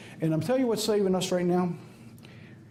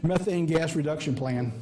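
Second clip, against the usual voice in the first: ordinary talk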